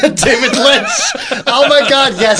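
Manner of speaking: high, loud laughing shout of recognition; laughing delight. Real, not acted. Laughing out the name at a friend's lookalike pick.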